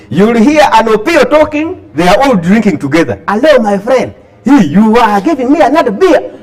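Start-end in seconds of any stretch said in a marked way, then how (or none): 3.3-6.4 a hint of a West Nile drinker's English accent, higher; lightly voiced West Nile man cadging a beer in a Kampala bar; playful West Nile accent asking for a beer. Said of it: "you are likely to hear an Adruga talking".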